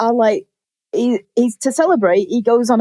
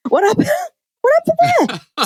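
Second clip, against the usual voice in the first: much higher, squealing through a laugh; shocked squeal. Retold or imitated. Reacting to the missing foot, high laughing squeal. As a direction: let the shock crack into a laugh.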